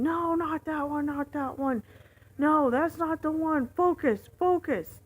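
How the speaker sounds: high, loud panicked yelling; frantic retold shouting; freaking out. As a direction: imitate a passenger shouting at the driver. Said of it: "freaking out when I get into the turn".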